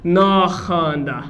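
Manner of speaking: नाखांदा said slowly, each syllable clear; teacher dictating नाखांदा slowly; slowly modelled word. Shown as a demonstration. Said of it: "क्या हो गया?".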